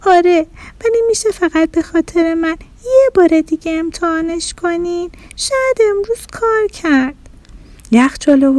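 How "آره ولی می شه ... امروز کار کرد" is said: put-on high little-kid plea; pleading child. A narrator's reading. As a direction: coax mom in a small child's voice. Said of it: "بچهٔ خونه گفت". (خواهش بچگانه با صدای نازک)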